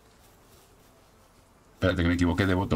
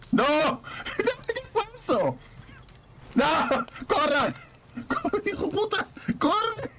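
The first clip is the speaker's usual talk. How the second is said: high-pitched squealing screams with laughter; excited shrieking; slower than usual. Real, not acted. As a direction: shriek in panic and delight. Chased in game, shrieking. (chillidos agudos entre risas)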